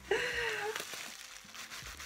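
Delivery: high, drawn-out giggly squeal; giddy laugh. Real, not acted. Vlogger opening her makeup parcel, giddy laugh. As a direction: laugh helplessly, high with excitement.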